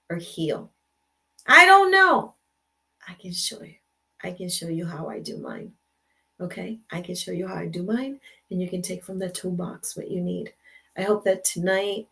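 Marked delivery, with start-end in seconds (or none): 1.5-2.3 much higher and louder burst; exclaimed. Real, not acted. Sudden exasperated outburst. 3.1-10.5 softer, gentle offer of help; soft reassuring. Real, not acted.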